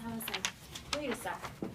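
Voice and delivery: usual speaking voice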